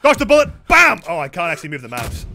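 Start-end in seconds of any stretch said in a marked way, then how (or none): 0.0-1.0 high, loud action call; excited shout. Real, not acted. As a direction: punch it out loudly like a blast. Calling out during game execution scene.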